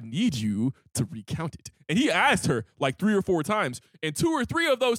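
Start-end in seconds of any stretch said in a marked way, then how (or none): 0.0-1.7 low, soft, measured calm; hostage negotiator voice. Retold or imitated. Friend retelling the bank standoff, hint of calm negotiator voice. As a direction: hold it down like talking someone off a ledge. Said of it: "same hostage negotiation voice".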